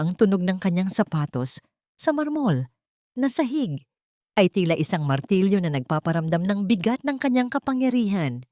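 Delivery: ordinary delivery, nothing marked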